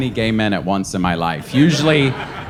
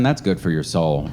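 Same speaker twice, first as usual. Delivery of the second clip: ordinary talk, nothing marked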